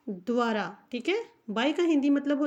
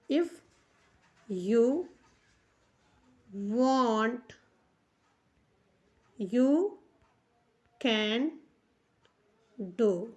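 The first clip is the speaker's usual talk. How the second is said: "if you want you can do" with long pauses between words; word by word while writing; 3 semitones lower, much slower than usual. Shown as a demonstration. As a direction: pause after each word as if writing it on the board. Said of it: "लिख के दिखा देती हूँ".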